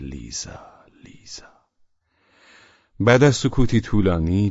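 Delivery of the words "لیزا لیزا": hushed, fading inner cry; very soft, low call from the heart; heart's inner cry. A narrator's reading. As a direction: let the name sink into a whisper.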